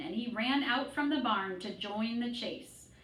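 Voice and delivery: usual speaking voice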